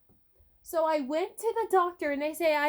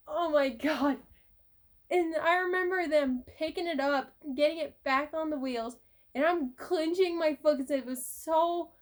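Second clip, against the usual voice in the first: normal talking voice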